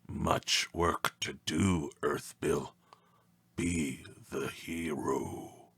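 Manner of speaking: low, slow ancient creature voice; ancient one. A narrator's reading. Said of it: "The Ancient One concluded".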